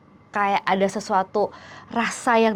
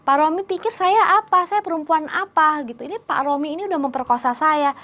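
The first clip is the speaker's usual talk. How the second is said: furious protest; 6 semitones higher than usual. Retold or imitated. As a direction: lash out at him in outrage. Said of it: "kesel banget". (marah dan kesal, suara tinggi)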